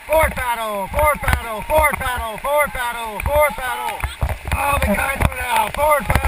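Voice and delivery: loud high rhythmic paddle calls; shouting over rushing water. Real, not acted. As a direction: chant the commands over roaring water. Guide shouting paddle commands in rapids.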